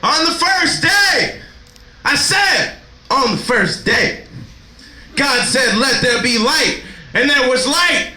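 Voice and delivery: high, slow preaching boom; mock preacher. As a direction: preach it like Genesis from the pulpit. Mock Genesis sermon.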